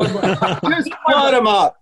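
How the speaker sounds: slow, laughing mock of the director; laughing imitation. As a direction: repeat the catchphrase through a laugh, hamming it up. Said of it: "Jess would say".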